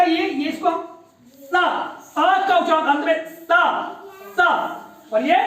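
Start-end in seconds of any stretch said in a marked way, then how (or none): 1.4-4.4 स with a clear hiss and a short अ at the end; dental sa. Shown as a demonstration. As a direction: slowly sound out स, ending on अ. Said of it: "ये वाला जो स है बेटा". (दंत्य स का धीमा, साफ़ उच्चारण)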